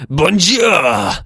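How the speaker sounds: mock heavy French accent. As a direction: say it like a French announcer, hammed up. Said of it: "he's got a really heavy French accent".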